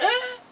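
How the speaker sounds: a high, strained retching groan; imitated dry heave. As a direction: mimic gagging with nothing coming up. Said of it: "I was literally going".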